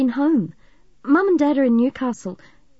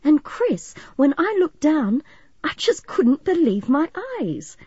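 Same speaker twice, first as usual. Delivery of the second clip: high, animated storytelling; eager and breathlessly amazed; excited; 7 semitones higher, 4 dB louder than usual. A narrator's reading. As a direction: recount an adventure with mounting wonder. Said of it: "went on excitedly".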